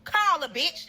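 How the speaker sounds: high, loud demand; yelling at him. Retold or imitated. Girlfriend yelling at lying boyfriend to call his mom. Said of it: "That's what I told him".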